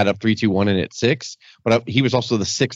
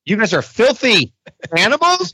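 mock-shocked interviewer voice, much higher; Mean Gene impression; 11 semitones higher than usual.

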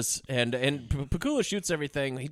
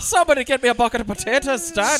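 cartoon Irish accent; 6 semitones higher, 6 dB louder than usual. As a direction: demand it stage-Irish. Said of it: "god-awful accent".

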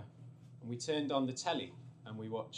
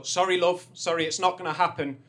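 brushing her off; 2 semitones higher, 8 dB louder than usual. Retold or imitated. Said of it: "he goes to this girl".

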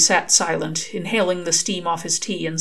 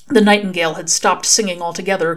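usual speaking voice

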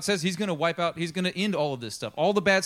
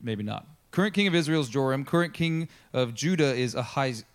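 ordinary talk, nothing marked

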